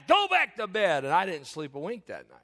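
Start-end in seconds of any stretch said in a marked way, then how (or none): 0.1-1.0 high, loud mock order; unsympathetic grandpa. Grandpa sending grandchild to bed, acted loud cross order. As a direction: cut the argument off crossly. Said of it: "very comforting people. Not".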